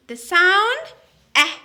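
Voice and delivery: short vowel eh as in apple; the sound eh, like the a in cat. Shown as a demonstration. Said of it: "Eh for apple".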